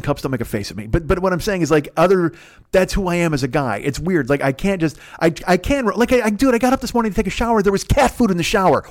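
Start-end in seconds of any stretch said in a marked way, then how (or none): none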